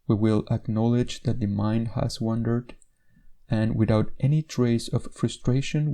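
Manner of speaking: normal talking voice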